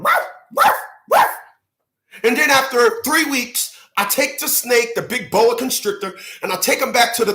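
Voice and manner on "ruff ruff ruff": high yappy ruff ruff, like a little dog; dog barking. Retold or imitated. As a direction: bark it out as a tiny dog would.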